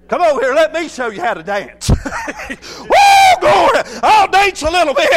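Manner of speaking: high, loud shouts broken by whoops and laughs; excited preaching. Real, not acted. Preacher showing how to dance and praise, excited shouted preaching. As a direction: whoop and shout with abandon, easing at the end.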